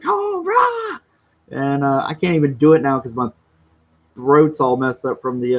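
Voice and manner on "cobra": high, drawn-out comic war cry; mock scream. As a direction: screech it like a battle cry. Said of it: "I kept screaming".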